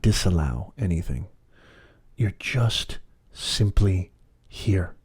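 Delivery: measured, unhurried meditation voice; guided meditation. Acted. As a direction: lead them gently inward, phrase by phrase.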